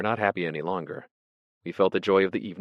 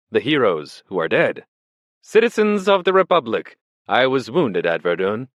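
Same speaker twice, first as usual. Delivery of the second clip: loud, high-pitched tub-thumping oratory; patriotic drunken speech; 6 semitones higher, 7 dB louder than usual. A narrator's reading. Drunk Furex addresses the bistro, loud patriotic oratory. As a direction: declaim it to the whole bar like a rousing orator. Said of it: "he made a patriotic speech".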